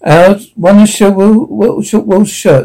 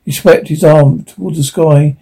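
ordinary delivery, nothing marked

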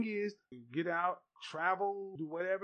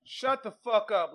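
louder, slow, furious; angry shout; 3 semitones higher, 7 dB louder, slower than usual. Real, not acted. Host telling the guest Lonnie Love off, slow and loud. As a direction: bark it at her slowly.

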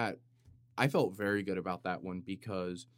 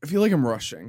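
louder, higher, panicked; mock panic, louder and higher; loud frantic repeat; 4 semitones higher, 11 dB louder, faster than usual. Shown as a demonstration. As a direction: blurt it out in a rush. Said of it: "Let's just calm down".

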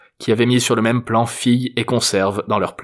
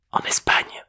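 hushed, fully whispered cue; whispered prompt; 3 dB quieter, slower than usual. A narrator's reading. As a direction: whisper the missing word to grandpa. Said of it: "lui souffla Jérôme".

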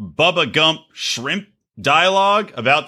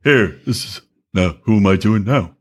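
lower, lightly put-on mock voice; Odie impression; 7 semitones lower than usual. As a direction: impersonate a cohost, teasing him. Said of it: "that's my Odie impression".

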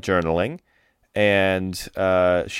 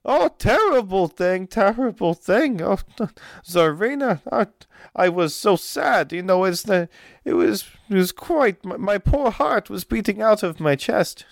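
high, dramatic voice with broken English; fretful noblewoman; 12 semitones higher than usual. Acted. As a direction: wring your hands over the poisoning. GM voices Olga at length.

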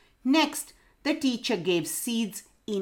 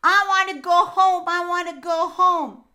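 high, loud whining little parrot; whiny baby parrot; 10 semitones higher, 7 dB louder than usual. A narrator's reading. Baby parrot to the owl teacher, high whining. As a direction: whine it like a cranky little bird. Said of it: "the parrot kept on whining".

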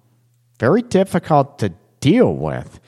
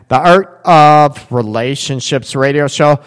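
ordinary talk

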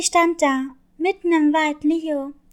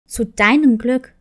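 low, louder gruff undertone; gruff wolf; 5 semitones lower, 6 dB louder than usual. Acted. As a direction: growl it curtly like a cross wolf. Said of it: "in einem barschen Unterton".

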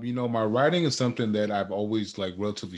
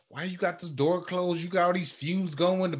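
mock scolding from wife, pitched up; imitating mad wife; 7 semitones higher than usual. Wife scolds him for the baby in the gym, mock annoyed. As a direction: play his annoyed wife, higher. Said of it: "she came in there mad".

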